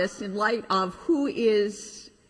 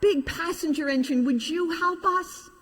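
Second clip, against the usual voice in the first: pleading toys; 8 semitones higher than usual. Acted. Toys begging the passenger engine, high hopeful plea. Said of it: "they cried out".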